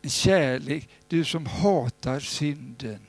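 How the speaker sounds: hushed earnest prayer. Acted. Leads the prayer.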